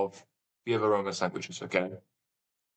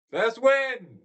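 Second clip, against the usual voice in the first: triumphant; 13 semitones higher than usual. Real, not acted. Celebrating a correct guess.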